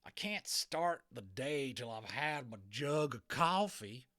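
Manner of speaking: loud, slow cowboy grumble; grumpy cowboy. A narrator's reading. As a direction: complain like a spoiled cowboy. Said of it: "he complained".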